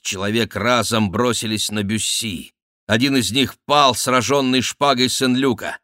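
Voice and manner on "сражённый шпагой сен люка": ordinary delivery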